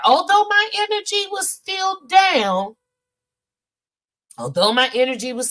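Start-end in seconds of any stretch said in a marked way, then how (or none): 0.0-2.8 raised, emphatic high voice; high, strained-up delivery; high-pitched exclamation. Real, not acted. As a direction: push it way up high. Repeats line high, then in usual voice.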